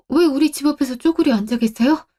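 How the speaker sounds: ordinary delivery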